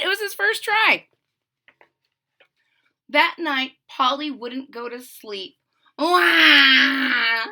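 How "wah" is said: loud high crying wail; baby wail. Acted. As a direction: bawl like a baby at night. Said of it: "she cried and cried".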